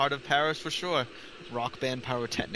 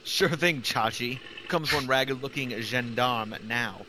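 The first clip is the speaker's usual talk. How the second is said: ordinary talk, nothing marked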